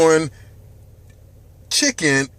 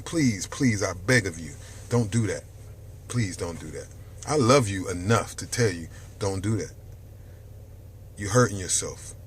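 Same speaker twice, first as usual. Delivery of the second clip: soft, lower pleading; 8 semitones lower, 7 dB quieter than usual. Real, not acted. Host begging his viewers to give up soda, soft and pleading. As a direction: beg them gently to stop.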